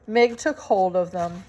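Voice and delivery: plain reading voice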